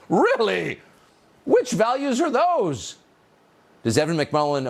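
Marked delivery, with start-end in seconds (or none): 0.1-3.0 高声、慢速的讥讽反问; mock disbelief. Real, not acted. Scoffing at the ad's claim.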